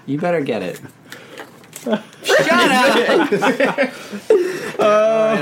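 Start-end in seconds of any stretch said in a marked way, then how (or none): 1.8-3.3 high laughing yell; laughing shout. Real, not acted. Mock outrage while laughing. 3.4-4.7 high burst of laughter. Real, not acted.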